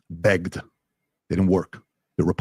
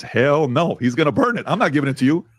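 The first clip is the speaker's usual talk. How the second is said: mom voice; 8 semitones higher than usual. Retold or imitated. Mom refusing in retold story.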